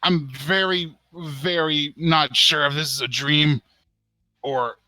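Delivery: dazed Want. Acted. Want introducing himself to the forest god, dazed. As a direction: sound awed and not quite sure it's real. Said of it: "Want will be like".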